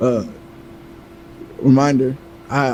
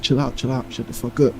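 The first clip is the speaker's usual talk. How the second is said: retold hushed command, softer; hushed order; 6 dB quieter than usual. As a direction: keep it down while telling her to shut up. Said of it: "And I was like".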